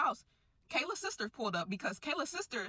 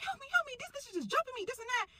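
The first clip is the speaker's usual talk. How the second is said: high, panicked cries for help; screaming for help. Retold or imitated. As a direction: shriek it like she's being dragged out. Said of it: "And she's screaming".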